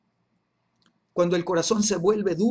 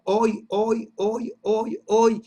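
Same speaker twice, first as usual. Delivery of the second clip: each HOY punched, higher; insistent repetition; 4 semitones higher, 5 dB louder, much slower than usual. Real, not acted. Preacher urging a continuous present, insistent. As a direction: drive home every "hoy" as a separate beat.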